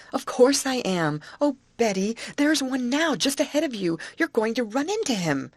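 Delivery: high, urgent warning; alarmed warning. A narrator's reading. Grace warns Betty of alligator.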